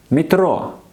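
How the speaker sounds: Metro said the Russian way, mee-TRO, high and clear. Shown as a demonstration. Teacher models Russian word for learners, slow and clear. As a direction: model Metro for the class to repeat. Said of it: "Let's do it one more time".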